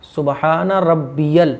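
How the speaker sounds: रब्बियल very slow, with the यल clearly sounded; careful teaching demo of रब्बियल; slow, correct pronunciation. Shown as a demonstration. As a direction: draw out सुबहान रब्बियल, stressing यल. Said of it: "वो रब्बियल है".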